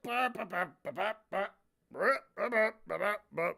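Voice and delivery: rapid mock chopping syllables; playful imitated line-drawing noises; careless slicing noises. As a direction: babble out little cartoon cutting sounds.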